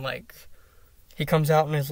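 normal talking voice

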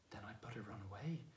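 pale, hushed and scared; a frightened boy's soft whisper. Acted. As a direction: breathe it out, frightened. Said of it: "turning very pale".

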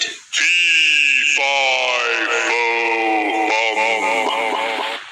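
booming giant, slow and low; giant shouting. Acted. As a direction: roar it out like a giant. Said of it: "The giant woke up and shouted".

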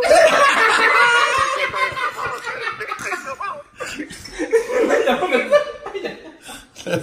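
high burst of helpless laughter; shrieking high laugh. Real, not acted. Friend laughing hard at the prank reveal, shrieking laugh. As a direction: let out a long squealing laugh.

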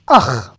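"Ach" with the vowel before the guttural; final chet as "ach". Shown as a demonstration. Teacher showing a word-final chet, said ach. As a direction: say "Ach" ending on a throaty rasped ch. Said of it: "encore ce ach et pas cha".

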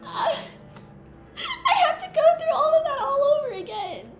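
high, slow wail with laughing sobs; despairing laugh-cry. Real, not acted. Player facing the climb again, high teary wail. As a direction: drag it out in teary disbelief. Must redo the whole climb.

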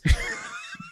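unvoiced burst of laughter; breathy laugh. Real, not acted. Laughs at own joke.

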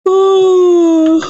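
a loud high surprised squeal; high excited "ooh". Real, not acted. Sudden reaction while playing.

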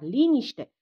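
loud, stern command; authoritative call for silence. A narrator's reading. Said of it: "Paulian intervenia autoritar".

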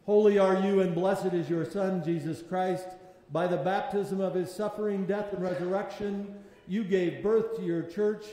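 loud, solemn intoning; liturgical intoning. Real, not acted. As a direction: proclaim it to the church. Eucharistic prayer intoned loudly.

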